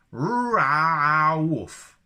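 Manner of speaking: loud, high mock Wookiee bark. Shown as a demonstration. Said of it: "How do you take your coffee?".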